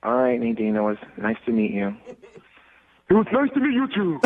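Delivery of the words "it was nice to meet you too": high, goofy cartoon-starfish voice; high put-on cartoon dimwit; Patrick. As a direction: sign off as a dopey cartoon character. Switches into Patrick voice for goodbye.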